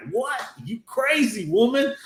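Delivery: high mock outrage on What; indignant disbelief. Brat answering the slave girl, high mock outrage. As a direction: pitch it way up in shocked disbelief. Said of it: "And he's like".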